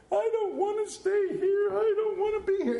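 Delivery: put-on high, far child's whine; whiny kid. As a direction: whine like a homesick kid at a sleepover. Child whining at slumber party.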